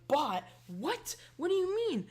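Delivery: high-pitched mock indignation; defensive. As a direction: get all defensive, pitch shooting up. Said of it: "get all defensive".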